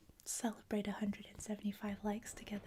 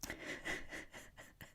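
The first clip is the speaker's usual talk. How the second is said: quiet whispered giggling; hushed amused laughter; breathy laugh; 6 dB quieter than usual. Real, not acted. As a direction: laugh under your breath, almost voiceless. Amused reading chat about cat request.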